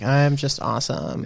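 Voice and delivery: soft, whiny self-praise; whiny Instagram influencer. Acted. Podcaster mocks his imagined influencer self for the audience, whiny. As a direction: whine it quietly, mocking an influencer. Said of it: "I did the whiny voice".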